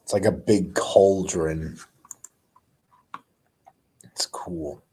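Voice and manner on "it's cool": soft whispered aside; whispering. Real, not acted. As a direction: breathe it out quietly.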